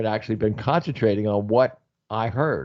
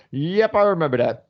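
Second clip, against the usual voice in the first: retold knowing reply; 8 semitones higher, 3 dB louder than usual. Retold reply about smell.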